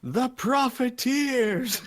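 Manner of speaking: high, drawn-out announcement with some doubt; unsure arena announcer. Acted. Announcer repeating the odd team name to the crowd, unsure. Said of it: "a little unsure".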